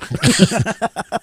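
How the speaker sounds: sharp high laugh. Real, not acted. Laughing at his own greed for the bet, high laugh. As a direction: burst out laughing.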